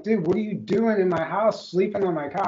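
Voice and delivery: loud, high retold outburst at the intruder; a hint of the mad yell, relived for the story; angry yell. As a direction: yell it like you caught someone in your house. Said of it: "I was so mad".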